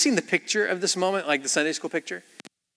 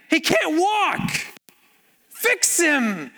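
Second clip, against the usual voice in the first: much higher, dragged-out pleading; slow high mock-desperate demand; high, slow exasperated plea; 12 semitones higher, much slower than usual. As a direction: plead upward in disbelief, slow. Friends' pleading punchline.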